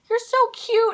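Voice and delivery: very high, loud imitated coo; gushing compliment. Singer complimenting a nervous fan, high gushing coo.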